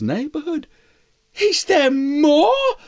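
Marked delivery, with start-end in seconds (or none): 1.4-2.8 mouse voice. Acted. Mouse asks eagle.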